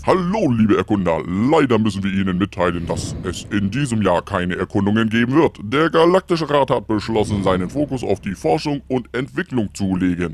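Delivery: booming public announcement over the comms; loud announcement. Acted. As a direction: announce it loudly to all explorers. Voice from the communicator.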